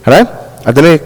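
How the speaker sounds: normal talking voice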